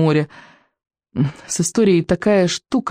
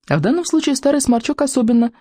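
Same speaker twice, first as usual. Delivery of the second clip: plain reading voice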